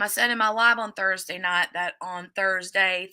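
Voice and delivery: usual speaking voice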